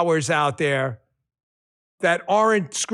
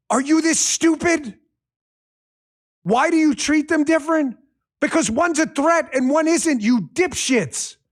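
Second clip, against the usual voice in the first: heated scorn, higher; contemptuous; 7 semitones higher than usual. Real, not acted. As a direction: sneer it at them. Contemptuous rant at critics.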